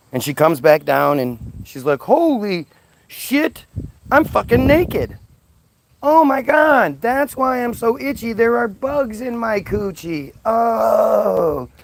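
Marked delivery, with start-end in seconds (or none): 2.0-11.7 high, startled outburst; suddenly aware and mortified; mock horrified shriek; shocked realization. Eve realizes she's naked.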